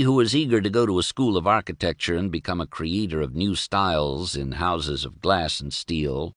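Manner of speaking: ordinary delivery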